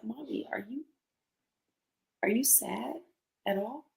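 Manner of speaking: put-on soft, hesitant child's question; imitated concerned child. Mother voicing son asking if she is sad, soft and hesitant. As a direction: ask very softly and haltingly, as her son would.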